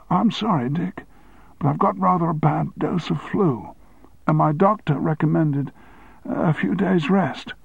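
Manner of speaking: high, thin apology; feeble sick man. Acted. Sick deputy apologizing to his chairman, feeble. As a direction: plead weakly from bed.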